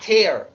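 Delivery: "tear" with an open "eh", like "tair"; tear as verb. Shown as a demonstration. Teacher repeats the verb form, "tear" said as TAIR. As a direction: say "tear" to rhyme with "bear". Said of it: "se dice tear".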